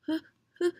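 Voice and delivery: normal talking voice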